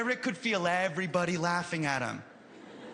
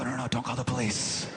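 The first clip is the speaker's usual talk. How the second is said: low scheming voice; 6 semitones lower than usual. Retold or imitated. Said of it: "I go".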